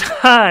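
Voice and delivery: a gloating little laugh as the balloon pops; mocking villain laugh. A villain laughs in the boy's face, gloating. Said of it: "And he goes".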